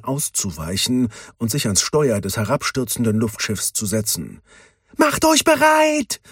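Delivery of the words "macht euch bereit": much higher, louder, slow warning call. A narrator's reading. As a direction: brace the crew for the crash. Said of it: "rief sie". (laut warnend, langsam und viel höher)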